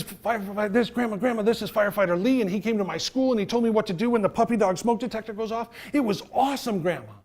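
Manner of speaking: put-on high, loud, breathless-with-news kid; hammed-up excited kid voice; excited little boy. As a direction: gush to Grandma like a thrilled child. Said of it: "Well, little Johnny says".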